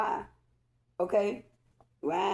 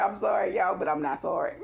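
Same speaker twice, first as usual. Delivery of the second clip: ordinary talk, nothing marked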